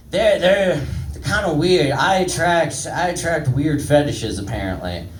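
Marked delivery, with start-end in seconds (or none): none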